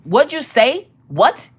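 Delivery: high, rising disbelief; sharp, affronted mom; indignant challenge. Retold or imitated. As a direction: challenge him to repeat that. Mom confronts son's attitude.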